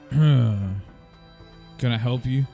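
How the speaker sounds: gruff and curt, sizing them up. A narrator's reading. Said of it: "in a gruff type of voice".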